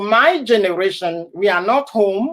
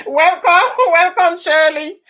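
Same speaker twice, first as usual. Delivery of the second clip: laughing welcome; 8 semitones higher, 4 dB louder than usual. Real, not acted. Greeting a viewer joining live.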